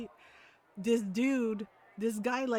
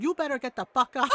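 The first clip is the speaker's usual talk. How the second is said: louder mom threat breaking into laughter; scolding mom; 5 dB louder than usual. Retold or imitated. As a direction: imitate mom's accented warning, then crack up. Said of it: "like, the mom tone and everything".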